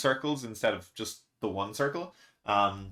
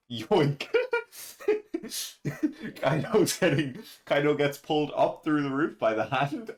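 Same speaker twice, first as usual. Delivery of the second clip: narrating through laughter; can't stop laughing while telling it; giggling through the words; 4 semitones higher than usual. Real, not acted.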